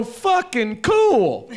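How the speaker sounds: high, slow, mocking "fucking cool"; defiant cool. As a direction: shrug it off with glee. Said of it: "I go".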